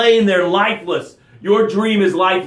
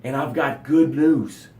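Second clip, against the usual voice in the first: normal talking voice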